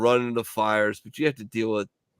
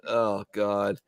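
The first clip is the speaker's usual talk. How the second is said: ordinary talk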